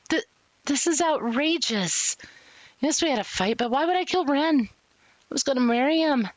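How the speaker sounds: high-pitched, acted outrage; indignant protest. Accused woman insists she loved him, indignant protest. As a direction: protest your innocence to the officer.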